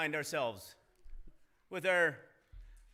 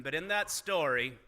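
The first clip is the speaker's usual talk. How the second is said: ordinary delivery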